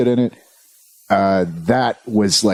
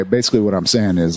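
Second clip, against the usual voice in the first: ordinary delivery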